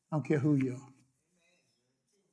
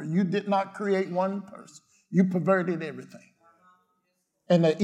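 ordinary talk, nothing marked

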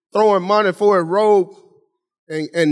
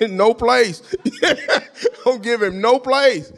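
higher-pitched, laughing between phrases; laughing defiance; 4 semitones higher, slower than usual. Real, not acted. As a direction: laugh it off and preach on. Laughs while defying the devil.

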